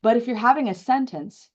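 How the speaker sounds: ordinary delivery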